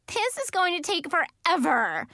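high-pitched impatient whine; exasperated complaint. A narrator's reading. Child complains during tense group task.

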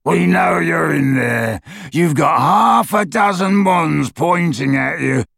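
high, slow rasping shout; harsh, rasping, menacing holler. A narrator's reading. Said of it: "came a rasping voice through the darkness".

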